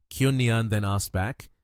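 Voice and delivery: ordinary delivery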